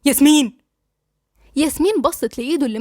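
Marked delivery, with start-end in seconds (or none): 0.0-0.6 sharp, louder call of her name; heated, forceful name-call; agitated. A narrator's reading. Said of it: "وقال لها بانفعال".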